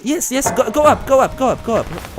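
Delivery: frantic high shouting; being chased. Real, not acted. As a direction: scream it while escaping.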